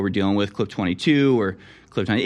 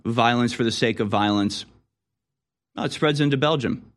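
usual speaking voice

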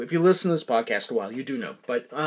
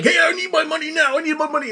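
impatient customer; 11 semitones higher, faster than usual. Retold or imitated. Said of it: "people are like".

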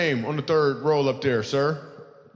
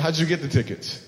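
ordinary talk, nothing marked

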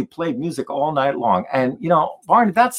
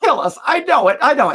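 high-pitched mock panic; retold fright, pitch jumping up; freaked out; 11 semitones higher, 3 dB louder, faster than usual. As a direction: play a kid convinced he's doomed. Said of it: "we're getting freaked out".

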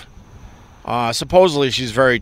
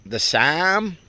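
Midwestern accent; 4 semitones higher than usual. Shown as a demonstration. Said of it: "what accent is that, Ope?".

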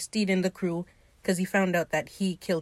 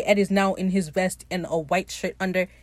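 usual speaking voice

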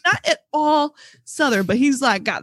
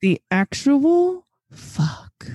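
very slow, emphatic outrage; exasperated disbelief; 4 semitones higher, much slower than usual. Real, not acted. Pressing the question about Alex's accent, drawn-out exasperation. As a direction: space the words out, utterly baffled.

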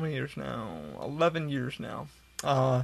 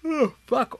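yawning; 9 semitones higher, 7 dB louder than usual. Real, not acted. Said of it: "I'm gonna yawn again".

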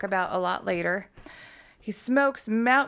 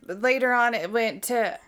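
ordinary delivery

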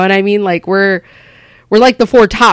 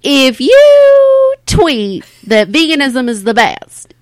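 slow stand-up setup with drawn-out opening; 5 semitones higher, 4 dB louder, slower than usual. Acted. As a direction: stretch the setup, then drop the punchline. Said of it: "do something like".

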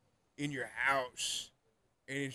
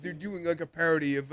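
ordinary delivery

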